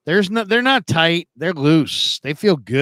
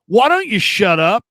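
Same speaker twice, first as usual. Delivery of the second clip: higher-pitched mock telling-off; retold put-down; 6 semitones higher, 4 dB louder than usual. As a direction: imitate a radio host ripping on a caller. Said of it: "he's kinda ripping on the guy".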